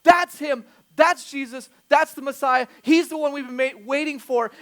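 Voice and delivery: loud, high, thrilled announcement; acted ecstasy. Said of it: "his emotion just went through the roof because he's ecstatic".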